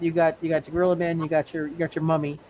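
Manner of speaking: ordinary talk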